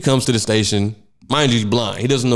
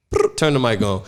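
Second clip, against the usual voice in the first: ordinary talk, nothing marked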